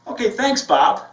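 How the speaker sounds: high, bright thanks with a smile; smiling thanks. Shown as a demonstration. Receptionist thanks caller Bob, smiling and upbeat. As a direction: smile through the words. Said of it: "and smile".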